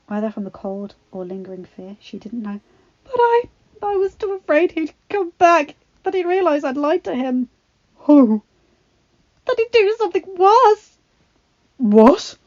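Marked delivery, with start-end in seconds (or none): 3.0-7.5 high, trembling confession; frightened. Acted. 8.0-8.4 sharp loud question; urgent beast prince. Acted. Adam demanding. 9.5-11.0 high, fearful whisper-cry; frightened. Acted. Belle frightened. 11.8-12.4 loud anxious question. Acted. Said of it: "Adam asked anxiously".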